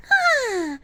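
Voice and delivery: playful high birdy voice, asking to be called pretty; pretty bird voice. Pretend. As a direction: chirp it high like a preening bird. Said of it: "Will I be your pretty burb?".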